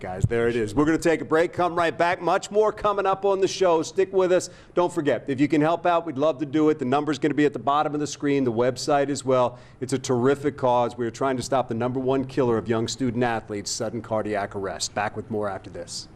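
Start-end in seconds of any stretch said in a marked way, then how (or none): 0.7-11.0 upbeat TV host; TV announcer. Acted. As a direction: pump up the break tease. Host throws to break.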